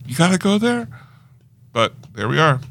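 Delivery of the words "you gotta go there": mock exasperation. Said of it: "and I was like".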